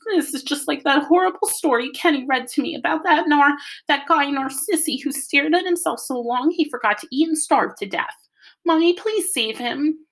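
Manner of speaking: put-on high, tearful little-kid outpouring; blubbering. A narrator's reading. Joey to Mommy about stuck Byron, blubbering. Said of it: "Joey blubbered out".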